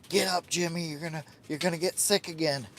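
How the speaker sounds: mock nagging voice of the algorithm; voicing the algorithm. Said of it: "The algorithm says".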